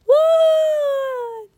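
put-on long high-pitched child's scream; piercing screamed wail. A narrator's reading. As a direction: shriek in panic, drawn out. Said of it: "The village kids screamed".

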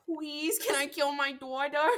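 laughing plea. Real, not acted. Joking plea to game master.

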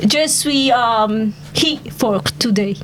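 hesitant, put-on French accent. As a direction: answer haltingly in a French accent. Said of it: "Can I speak with a French accent?".

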